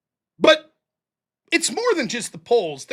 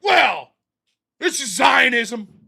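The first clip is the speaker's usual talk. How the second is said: mocking chat commenter; much slower than usual. Mocking a chat troll to his viewers, slow and put-on. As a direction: drag it out like a smug troll. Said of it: "someone in the chat would be like".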